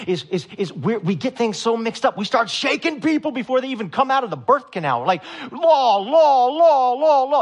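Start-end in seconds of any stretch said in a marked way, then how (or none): 5.5-7.4 much higher, louder, pounding each law; hammering shout. Retold or imitated. Preacher mocking churches that shake new believers, loud hammering chant. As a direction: drum the word into them.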